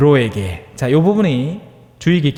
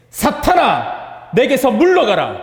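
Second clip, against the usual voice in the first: high, drawn-out stern command; stern rebuke; 6 semitones higher, slower than usual. Retold or imitated. Jesus rebuking Peter before the disciples, stern and drawn out. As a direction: rebuke him sharply and slowly. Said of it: "강한 어조로 이야기를 하는 거죠".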